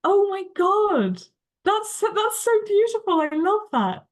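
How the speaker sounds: overwhelmed with delight, voice jumping up; touched by the news about Marie; delighted surprise. Real, not acted. Moved by the author's story.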